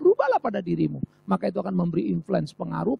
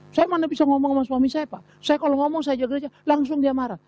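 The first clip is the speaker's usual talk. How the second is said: higher, plaintive voice of a helpless wife; wife protesting to the pastor, higher and plaintive; complaining wife; 7 semitones higher than usual. Retold or imitated. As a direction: whine it like a wife who has given up.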